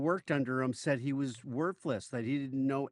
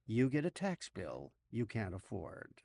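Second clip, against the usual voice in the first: softer, lower, let-down repeat; deflated aside; 4 semitones lower, 6 dB quieter than usual. Acted. Host undercuts the tax bill shout, quiet and deflated. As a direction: drop the hype and say it quietly.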